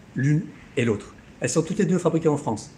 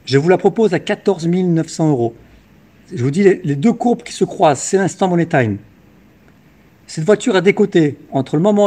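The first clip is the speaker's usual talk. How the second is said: loud, punchy sales pitch; infomercial; 6 dB louder than usual. Acted. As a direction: hype it up like a TV car dealer. Car dealer hard-sell pitch.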